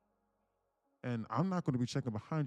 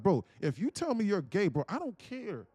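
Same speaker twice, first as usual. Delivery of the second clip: much higher and louder, demanding; retold exasperated demand, much higher; exasperated pressing; 8 semitones higher, 3 dB louder than usual. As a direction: push hard for the truth. Pressing brother for the truth.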